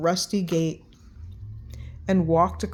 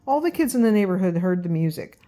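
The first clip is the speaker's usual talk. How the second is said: ordinary delivery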